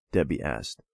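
ordinary delivery

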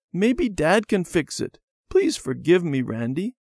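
put-on high child's voice, coaxing; pleading. A narrator's reading. Debbie begging Randy to forgive her, pleading. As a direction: plead high with a cross brother. Crying sister begs forgiveness.

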